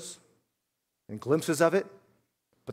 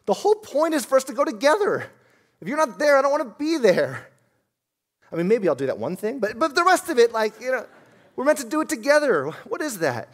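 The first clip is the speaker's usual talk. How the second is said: high-pitched, hammed-up baffled husband; protesting groom; 8 semitones higher, faster than usual. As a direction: act out a groom pleading to go together. Acted groom protests to bride.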